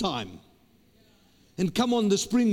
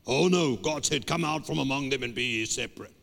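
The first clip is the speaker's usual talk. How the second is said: lower, faster prim voice of a separatist believer; mock pious churchgoer; 8 semitones lower than usual. Preacher mocking churchgoers who avoid sinners, prim and lower. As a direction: mimic a holier-than-thou believer, lower and quick.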